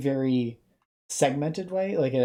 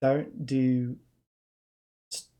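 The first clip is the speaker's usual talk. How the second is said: New Zealand accent; slower than usual. Shown as a demonstration. Streamer saying don't do Twitch streams, in an accidental New Zealand accent. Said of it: "I'm switching into my Ze-, New Zealand accent".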